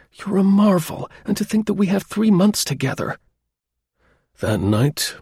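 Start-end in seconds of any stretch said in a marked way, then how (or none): 0.1-3.2 much higher, thrilled daughter; enthusiastic. A narrator's reading. Sarah praising her father, enthusiastic. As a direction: gush with delighted admiration. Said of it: "she exclaimed enthusiastically".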